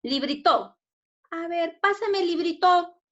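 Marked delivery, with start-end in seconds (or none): 0.0-0.8 "Librito" with the last syllable TO punched; wrong stress on TO. Shown as a demonstration. Teacher trying the wrong syllable with pupils, stress on final TO in "Librito". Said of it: "mayor fuerza de voz en la to". 1.8-3.0 "librito" ending on a punched TO, higher pitch; wrong stress on TO. Shown as a demonstration. Teacher testing it in a request, stress on final TO in "librito". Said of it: "mayor fuerza de voz en la to".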